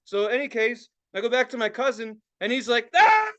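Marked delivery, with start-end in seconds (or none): 3.0-3.3 loud, high burst of laughter; imitated guffaw. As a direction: explode like a cousin laughing hard. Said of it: "He's laughing so fucking hard".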